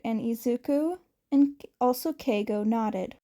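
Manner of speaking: plain reading voice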